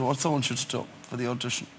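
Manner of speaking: low-pitched, softly spoken director; low voice, measured pauses between phrases; commercial director impression. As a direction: imitate a director calmly giving absurd instructions. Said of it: "And he spoke like this".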